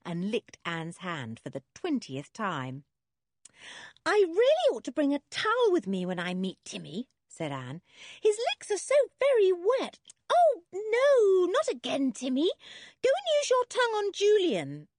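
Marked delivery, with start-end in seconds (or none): 4.0-7.1 put-on high-pitched girl, louder; raised girlish grumble; exasperated young girl. A narrator's reading. 8.2-14.9 put-on very high, loud girlish protest; high, wailing mock dismay; squealed protest. A narrator's reading. Anne protests at dog licking her.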